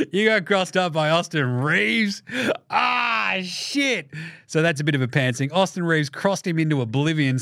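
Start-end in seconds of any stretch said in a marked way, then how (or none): 0.0-4.1 hammed-up teasing through laughs, drawn out; mock jeering laughter. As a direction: rib a teammate with a slow, laughing taunt. Acted locker room laughing at Norm.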